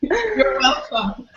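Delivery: laughing through the words. Real, not acted. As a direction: say it while cracking up. Jokey reply to compliment.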